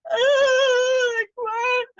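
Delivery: high, loud overwhelmed squeal; overwhelmed excitement. Retold or imitated. Newcomer reenacting her red-carpet welcome, squealing in overwhelmed excitement. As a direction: act out stunned delight in a very high voice. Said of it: "but super excited".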